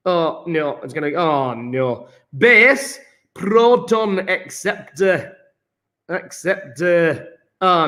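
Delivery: broad put-on Yorkshire with rolled R's; Yorkshire accent. Shown as a demonstration. Teacher explaining acids and bases, put-on Yorkshire accent. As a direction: keep up the hammed-up Yorkshire accent. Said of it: "rest of lesson in Yorkshire".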